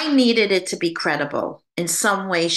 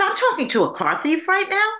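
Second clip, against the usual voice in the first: surprised; 11 semitones higher than usual. Retold or imitated. Shocked question to the spirit.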